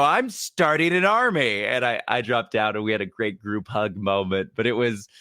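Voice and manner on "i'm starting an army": higher, slow mock-heroic declaration; playful hero quip. Spider-Man quipping to his manager, mock-heroic and high. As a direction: announce it like a grand hero.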